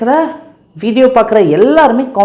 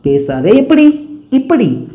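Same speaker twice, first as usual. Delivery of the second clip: ordinary delivery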